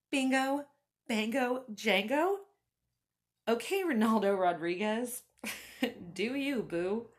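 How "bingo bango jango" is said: higher, louder disbelieving echo; incredulous repeat. Retold or imitated. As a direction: repeat it back like you can't believe it. Incredulous repeat of his words.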